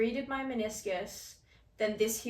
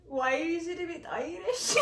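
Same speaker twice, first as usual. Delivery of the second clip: laughing; 7 semitones higher, slower than usual. Real, not acted. Laughing at her own accent.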